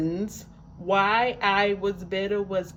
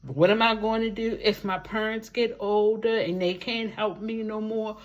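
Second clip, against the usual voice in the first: ordinary talk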